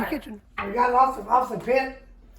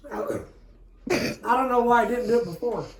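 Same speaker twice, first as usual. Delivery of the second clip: mouth full. Real, not acted. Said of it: "why you talk with your mouth full".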